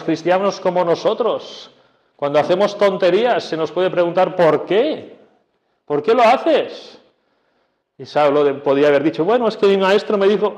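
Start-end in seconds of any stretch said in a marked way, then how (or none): 4.3-7.0 pregunta insistente. Acted. Pregunta a cristianos tontos. 9.2-10.5 excusa rápida. Pretend. Excusa imaginada de Saulo.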